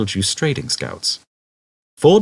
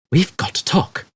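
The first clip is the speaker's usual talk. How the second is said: urgent and pitched higher; 11 semitones higher, 3 dB louder than usual. A narrator's reading. Ford squatting beside Arthur, urgent. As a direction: press it home with urgency. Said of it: "he said urgently".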